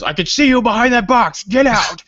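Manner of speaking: high, loud bullhorn-style yell through a bad speaker; loudspeaker shout. Friend calling over the loudspeaker at a hiding player, loud tinny shout. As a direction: bark it out like a tinny in-game loudspeaker. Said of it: "sounds like it's in a s-, loudspeaker".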